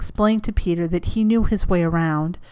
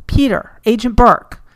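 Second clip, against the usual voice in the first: loud, higher shouts into the rubble; calling out; 4 semitones higher, 7 dB louder than usual. Acted. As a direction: yell his name, then his title. Calling into a collapsed building.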